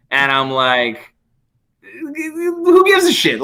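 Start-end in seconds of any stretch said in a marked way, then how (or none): 1.8-3.4 high, slow, scornful "who gives a shit"; scoffing disbelief. Retold or imitated. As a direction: pitch it way up in mock outrage. Retold defiant answer to cop.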